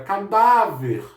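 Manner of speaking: cadáver said slowly, each syllable clear; slow demo word. Shown as a demonstration. Teacher slowly models the word for students, careful. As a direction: spell cadáver out slowly with the stress on dá. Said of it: "Por que que o dá é acentuado?".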